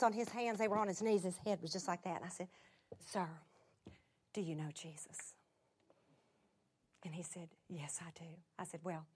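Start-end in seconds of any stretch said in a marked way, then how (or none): 3.0-5.3 very low, hushed question; soft, intimate asking; tender whisper. Retold or imitated. Tender question to the seated man. 7.7-8.3 very low, soft answer; reply gently, almost whispering; hushed, simple yes; quiet admission. Retold or imitated. Man's quiet answer, retold.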